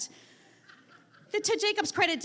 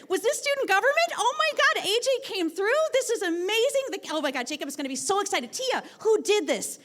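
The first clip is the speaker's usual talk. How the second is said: much higher, louder gushing; overjoyed; 8 semitones higher, 4 dB louder than usual. Retold or imitated. Teacher gushing to her aide about the posters, overjoyed. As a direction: spill over with excitement. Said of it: "I was over the moon".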